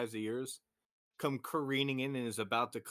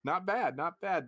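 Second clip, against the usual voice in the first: upbeat approval; 6 semitones higher, 4 dB louder than usual. Real, not acted.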